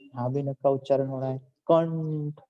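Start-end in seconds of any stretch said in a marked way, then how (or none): 1.4-2.4 कंठ very slow, with a long held n; n sound sounded. Shown as a demonstration. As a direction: stretch the nasal before ठ in कंठ. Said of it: "यहाँ भी हो रहा है न का उच्चारण". (न को लंबा खींचकर कंठ)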